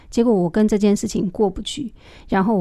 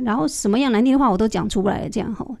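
usual speaking voice

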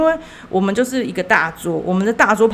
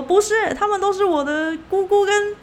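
relative correcting; 9 semitones higher than usual. Retold or imitated. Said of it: "中国亲戚就说".

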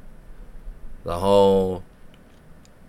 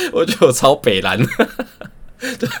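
louder, laughing self-mockery; 6 semitones higher, 7 dB louder than usual. Real, not acted. Vlogger laughing at how cheeky she was, giggling loudly. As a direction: crack up at your own cheek.

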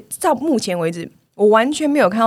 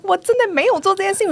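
protesting innocence; 10 semitones higher than usual. Retold or imitated. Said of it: "我说".

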